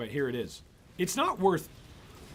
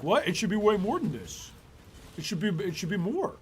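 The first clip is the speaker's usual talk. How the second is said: higher mocking whiny voice, a bit louder; mocking a complaining player; 6 semitones higher, 4 dB louder than usual. Streamer mocking a player who complains about returns, higher mocking whine. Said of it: "I'm even mocking, I'm mocking him".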